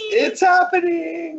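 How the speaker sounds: high-pitched excited whoop; excited shout. Real, not acted. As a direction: call it out high and stretched, thrilled. Co-host cheers the announced release.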